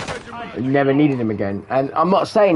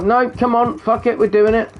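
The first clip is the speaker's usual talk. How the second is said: higher, pushy pep talk to himself; self pep talk; 9 semitones higher than usual. Retold or imitated. Talking himself into a workout, pumped-up pep talk. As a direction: gee yourself up like your own cheerleader. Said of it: "my own cheerleading enemy".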